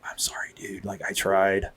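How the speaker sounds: soft, breathy apology; hushed apology. Retold or imitated. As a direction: say sorry under your breath to the medics. Apologising quietly to firefighters.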